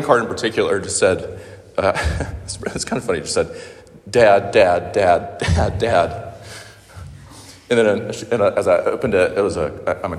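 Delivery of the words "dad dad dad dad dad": a louder, lightly voiced little girl calling for her father, between laughs; child calling dad. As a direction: call Dad over and over like a five-year-old, laughing at it. Child's card read aloud.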